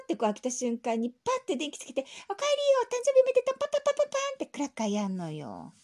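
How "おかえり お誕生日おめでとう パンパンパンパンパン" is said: very high loud festive cheer; surprise shout. Retold or imitated. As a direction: burst out celebrating. Surprise party shout acted out. (大はしゃぎで叫ぶ)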